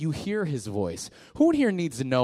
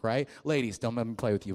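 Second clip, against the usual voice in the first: normal talking voice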